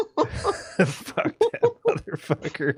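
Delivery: high, slow words through laughter; laughing dismissal. Real, not acted. Storyteller dismisses the guy he pranked, laughing. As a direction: curse him while cracking up.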